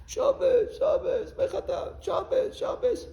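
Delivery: high, wailing mock cry; Chafetz Chaim crying. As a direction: imitate a tearful, pleading wail. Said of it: "The Chafetz Chaim started to cry".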